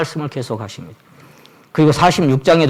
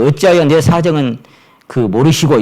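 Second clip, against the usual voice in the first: complaint; 4 semitones higher, 6 dB louder than usual. Retold or imitated. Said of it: "이렇게도 돼 있고".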